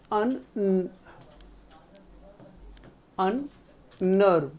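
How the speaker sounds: अननर्व sounded out very slowly, syllable by syllable; slow sounding out. Shown as a demonstration. Teacher sounding out the word very slowly for students. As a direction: draw out अन- and then अननर्व slowly. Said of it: "किसी word के एंड में".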